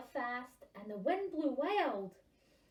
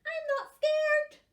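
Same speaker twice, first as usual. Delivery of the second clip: put-on high bold child; child's voice; 13 dB louder than usual. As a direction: puff up like a brave little kid. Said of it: "said the Gruffalo's child".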